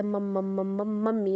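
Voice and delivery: stuttering repetition of "मम" before "मम्मी" comes out; demonstrated stutter. Shown as a demonstration. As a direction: get stuck repeating "मम" in an even run, then finish "मम्मी". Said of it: "को वो बार बार repeat कर रहा है".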